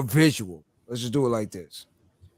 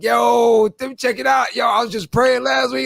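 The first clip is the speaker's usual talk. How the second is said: excited shout; 6 semitones higher than usual. Retold or imitated. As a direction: call it out all fired up. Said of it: "You're like".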